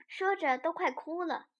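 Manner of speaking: usual speaking voice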